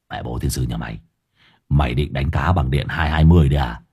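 low monotone voice of a gruff rascal; low-pitched character voice, reproachful; Hải Nghẹo, scolding. A narrator's reading.

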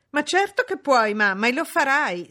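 loud, high, imperious insistence; haughty, emphatic. A narrator's reading. Daughter overrules her mother, haughty and emphatic. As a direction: hammer it out haughtily. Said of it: "scandì altera Blanche".

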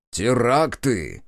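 slow, booming radio announcer; menacing announcer. A narrator's reading. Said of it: "грозно сказал он". (грозным дикторским голосом)

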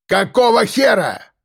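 high-pitched shout of alarm; angry roar. A narrator's reading. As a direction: roar it toward the bushes. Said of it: "взревел Кирпич".

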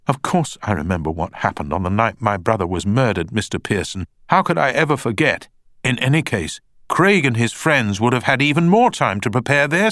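a hint of an indignant young witness; young woman witness. A narrator's reading. Beth rebuts the barrister's insinuation, lightly voiced and indignant.

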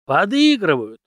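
punchy, certain reply from the teacher; emphatic retort. A narrator's reading. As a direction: toss the answer back forcefully. Teacher's emphatic one-word answer.

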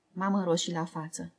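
usual speaking voice

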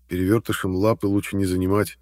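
ordinary delivery

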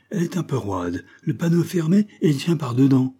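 captain's surprised outcry, lightly done; exclaiming. A narrator's reading. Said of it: "cria-t-il".

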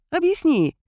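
bright, cheerful prompt. A narrator's reading. Kailin cheerfully asks Vitka to explain. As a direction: toss it out merrily. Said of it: "весело предложил Кайлин".

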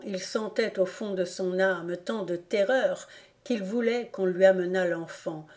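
ordinary delivery, nothing marked

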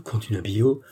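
ordinary delivery

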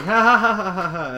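high put-on "ha ha" laugh; mock group laughter. Everybody laughing at Gilda, mock laughter. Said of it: "and everybody's laughing".

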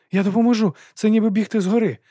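high, loud insistence; urgent pleading. A narrator's reading. Boy to his collapsed master, urgent pleading.

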